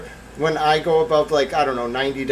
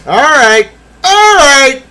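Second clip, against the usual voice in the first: high loud excited repeats; hyped all right; 12 semitones higher, 13 dB louder, much slower than usual. Acted. Streamer hyping the moment to chat. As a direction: pump up the room.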